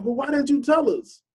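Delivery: a hint of sulky children whining back; mock upset kids. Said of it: "And they were so mad".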